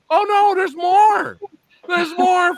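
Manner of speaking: high, stretched-out disbelief; amazed. Retold or imitated. Retelling the scene after the credits, amazed.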